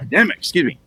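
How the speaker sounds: ordinary delivery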